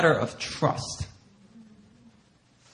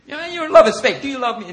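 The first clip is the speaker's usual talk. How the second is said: higher, louder teasing doubt; mock skeptical Jesus; 8 semitones higher, 5 dB louder, faster than usual. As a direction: play the doubting master half in jest.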